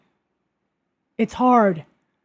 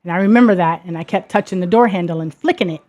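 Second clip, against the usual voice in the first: ordinary delivery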